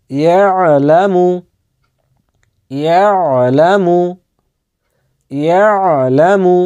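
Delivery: ইয়ালামু stretched slowly, the long আ held; slow careful recitation. Shown as a demonstration. Teacher repeating the word for learners, slow and careful. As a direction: draw out ইয়ালামু gently three times. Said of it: "উচ্চারণগুলো দেখেন ভালোভাবে".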